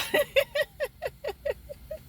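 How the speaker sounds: long, very high giggle; real giggle. Real, not acted. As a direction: burst into giggles at yourself. Laughs at calling self weirdo.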